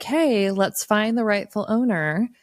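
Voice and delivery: usual speaking voice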